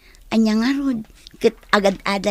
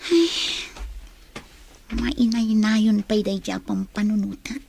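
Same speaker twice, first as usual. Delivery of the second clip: usual speaking voice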